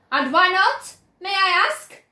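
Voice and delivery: loud, high demanding shout; mother shouting her challenge at Wonka. A narrator's reading. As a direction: challenge him hard. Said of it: "shouted Missus Gloop".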